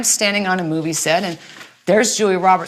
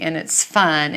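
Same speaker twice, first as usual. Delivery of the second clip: ordinary delivery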